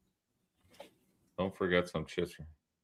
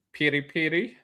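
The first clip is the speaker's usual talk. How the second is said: higher-pitched mock Indian accent with tapped r's; put-on Indian accent; 6 semitones higher than usual. Shown as a demonstration. Showing a friend the chip flavor sounds better accented, put-on Indian accent. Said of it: "If you say it with an accent".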